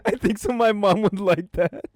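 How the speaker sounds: high, loud quote broken by real laughter; laughing. Real, not acted. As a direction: crack up while delivering the line. Teller cracks up at the lie.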